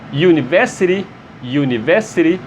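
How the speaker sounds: University begun with a Y sound; English pronunciation. Shown as a demonstration. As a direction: open University with a Y glide. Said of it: "é o som também de Y". (University com som de Y no início)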